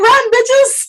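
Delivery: gleeful mock cry. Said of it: "She's like".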